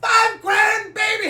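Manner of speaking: high, loud, drawn-out victory cry; slow it down and savor the win; triumphant yell; jubilant shout. Retold or imitated. Dad celebrating the win.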